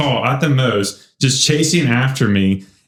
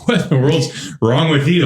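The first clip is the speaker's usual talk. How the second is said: lightly voiced dad, a bit higher; a hint of a baffled father; dad's puzzled demand; 4 semitones higher than usual. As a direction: toss off dad's baffled question. Dad reacting to sweaty son.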